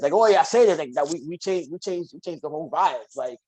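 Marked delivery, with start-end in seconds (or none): 0.1-0.8 casual street talk. Retold or imitated. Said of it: "when I'm out with my street friends".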